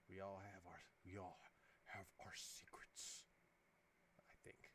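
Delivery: slow secretive whisper. Real, not acted. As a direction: let it drop to a hush. Confiding about keeping secrets.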